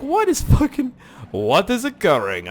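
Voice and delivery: loud, incredulous outburst; mock bewilderment. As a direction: start high, then slow in disbelief. Retold bewilderment.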